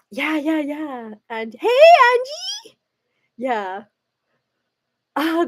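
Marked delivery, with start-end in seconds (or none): 1.6-2.8 high sing-out hello; excited greeting. Real, not acted. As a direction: call out a drawn, high-pitched greeting to a friend. Greeting a viewer who joins.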